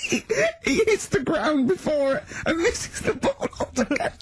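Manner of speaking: very high, squeaky talk through laughing; real tearful-sounding hysterics; helpless laughter. Real, not acted. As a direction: crack up so much you can barely finish. Helpless laughter recalling missed shot.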